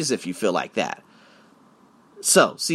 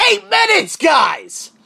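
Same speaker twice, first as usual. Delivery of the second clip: much higher and louder, calling out the time triumphantly; excited shout; 13 semitones higher, 6 dB louder, much slower than usual. Real, not acted. Bragging to viewers about the quick visa, excited shout. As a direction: shout it like a proud announcement.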